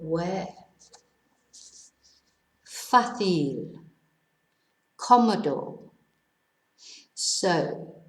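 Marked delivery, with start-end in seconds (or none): 2.7-3.8 "Fácil" as FA-cil, stressed at the start; stress on first syllable. Shown as a demonstration. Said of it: "tells us to stress the first syllable". 5.0-5.8 "cómodo" as CO-mo-do, first syllable stressed. Shown as a demonstration. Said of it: "cómodo tells us to stress the first syllable".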